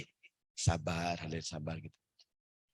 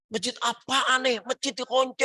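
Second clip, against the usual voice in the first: mock outrage, pitched way up; angry grumble; 11 semitones higher than usual. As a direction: play the huffy old haji at the locked door. Angry haji at locked mosque.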